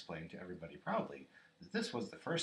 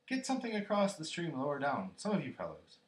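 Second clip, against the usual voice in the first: loud, higher-pitched order; raised voice giving instructions; calling out; 7 semitones higher, 6 dB louder than usual. A narrator's reading. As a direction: call urgently to the others. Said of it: "called Rabbit".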